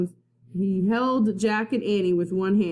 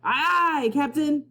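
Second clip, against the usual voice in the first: pirate crewman; 8 semitones higher than usual. Acted. Pirates answering the captain.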